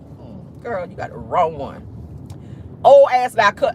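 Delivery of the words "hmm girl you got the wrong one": low snap-back. Retold or imitated. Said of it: "I was like".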